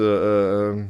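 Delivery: normal talking voice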